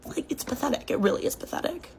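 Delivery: hushed, whispered scorn; whispered contempt. Real, not acted. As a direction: whisper the verdict with disdain.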